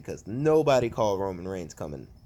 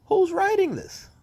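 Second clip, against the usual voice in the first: high, loud exasperated cry; outraged; 6 dB louder than usual. Real, not acted. Fan yelling at the show's writers, outraged. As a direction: demand an answer, fed up. Ranting about the booking.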